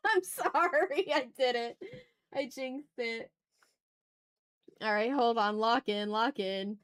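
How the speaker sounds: ordinary talk, nothing marked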